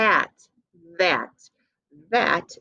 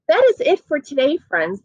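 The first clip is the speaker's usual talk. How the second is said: high, bright wrap-up; cheerful sign-off; 9 semitones higher, 3 dB louder than usual. Real, not acted. Teacher brightly ending class for kids. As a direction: close the lesson with cheer. Wrapping up the lesson.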